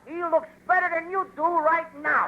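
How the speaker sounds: high, slow, drawn-out jibe; emphatic punchline. Acted. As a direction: stretch the insult out high. Gleeful punchline insult to partner.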